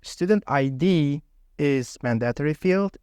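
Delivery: normal talking voice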